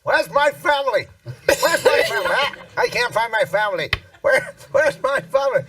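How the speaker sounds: put-on a lost old man calling for his family. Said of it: "this guy going".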